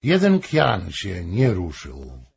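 plain reading voice

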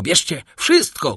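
the banker breaks and shouts. A narrator's reading. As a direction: throw it all away in a shout. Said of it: "krzyknął Danglars".

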